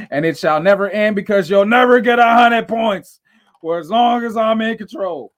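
loud, high villain proclamation; villainous boast. Acted.

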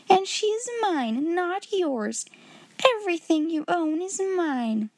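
high, possessive outburst; indignant headmistress. A narrator's reading. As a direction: claim it all with haughty indignation. Headmistress claims girl's belongings.

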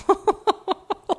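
high loud burst of laughter; amused outburst of laughter; real laugh. Real, not acted. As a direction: crack up suddenly. Laughing at own words.

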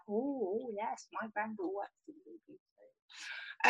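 imitating a Grenadian grandma's accent, quiet and proud. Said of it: "I don't know why I did that accent".

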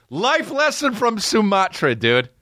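very high, drawn-out crowing with a laugh; gleeful proclamation. Acted. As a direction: crow it in a high, stretched voice. Gleefully repeats the life lesson.

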